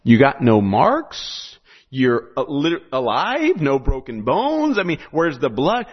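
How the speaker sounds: a baffled pastor, slow and booming; incredulous pastor. Acted. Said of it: "And the pastor's like".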